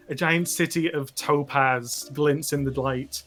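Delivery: ordinary talk, nothing marked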